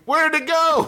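high-pitched comic scream; wailing, high put-on shriek; mock scream. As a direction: scream it in mock panic at a sudden loss. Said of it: "look down and scream".

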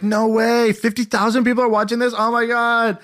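high-pitched mock astonishment; amazed girl, imitated. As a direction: gush in disbelief like a starstruck girl. Retold girl amazed at livestream.

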